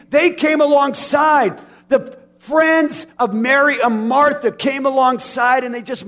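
loud, pressing pulpit delivery; earnest preacher building to a shout; fervent preaching. Acted. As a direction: drive the point home to the congregation. Preaching with rising force.

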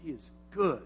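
ordinary talk, nothing marked

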